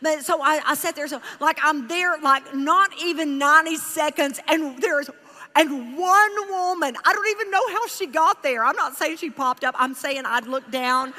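ordinary talk, nothing marked

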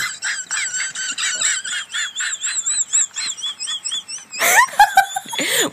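long high breathless laugh; wheezing laugh. Real, not acted. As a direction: laugh until you can't breathe.

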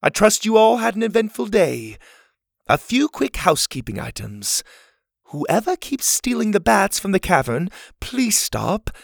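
lightly voiced genteel leader; camp leader Ma. A narrator's reading. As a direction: address the campers like a kindly matron. Ma's announcements.

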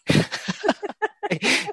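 real laugh, jumping very high. Real, not acted. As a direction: burst out laughing. Laughing at Klopp joke.

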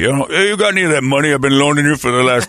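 mumbling Stallone voice; lightly voiced Sly on the phone; Sylvester Stallone impression. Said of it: "it's a Sly".

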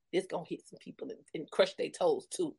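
low whispered jab; whispered aside. Real, not acted. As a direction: hush it like a secret. Unvoiced aside.

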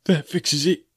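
high, breathless frightened line; gasped fright. Acted. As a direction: gasp it out in fear. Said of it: "gasped one".

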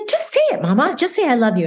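put-on light high child's coaxing; daughter urging. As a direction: coax like a small girl.

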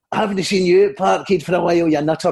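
Billy Connolly. Acted. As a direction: put on a cheeky comedian's voice. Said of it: "as Billy Connolly and I went".

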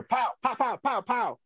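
high mock gunfire pops, pow pow; imitated gunshots. Trapped officer mimicking the gun firing nearby, high pops. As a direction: mimic the pistol shots going off above. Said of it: "I hear".

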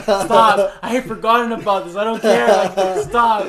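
exasperated brother. Retold or imitated. Brother telling him to stop apologising.

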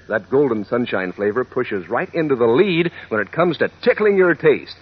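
normal talking voice

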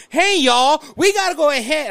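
high, loud Southern "hey, y'all"; Southern accent. Acted. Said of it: "I'm using my Southern accent".

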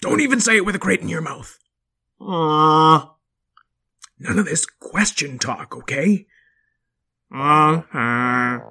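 firm lecturing dad; loud dad voice. Acted. Father dinosaur voice.

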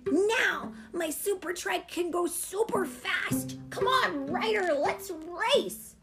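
put-on high-pitched, eager child voice; excited kid shouting. A narrator's reading. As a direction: urge a friend on with thrilled energy. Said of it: "Alex shouted".

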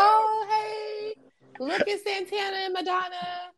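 high mocking voice. Mocking quote of the public.

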